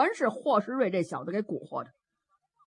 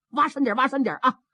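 quick, urgent command; urgent order; 3 semitones higher, 3 dB louder, faster than usual. Acted. Father to his sons burying a body, urgent hurried order. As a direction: rattle it out fast, hurrying them on.

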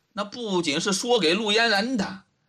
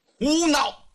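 sudden higher-pitched outburst; angry rebuke; 7 semitones higher than usual. A narrator's reading. Old judge loses patience with the suspect, angry rebuke. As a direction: cut in with indignant reproof. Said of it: "老头生气了".

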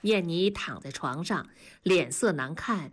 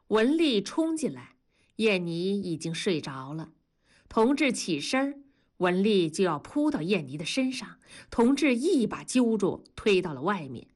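ordinary delivery, nothing marked

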